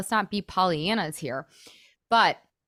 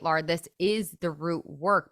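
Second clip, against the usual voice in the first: usual speaking voice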